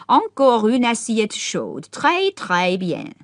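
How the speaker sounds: very slow, approving murmur; approvingly to herself. A narrator's reading. Old Duchess approving the hot plate, very slow. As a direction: savour it, pleased. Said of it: "say approvingly to herself".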